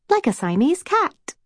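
high-pitched little-sister interjection; eager suggestion. A narrator's reading. Snow interrupts her sister's story, offering eagerly. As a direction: chip in, pitched well up with a rising end. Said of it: "Snow offered".

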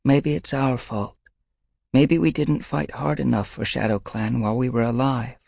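gentle sorrow, blaming herself; sorrowful. A narrator's reading.

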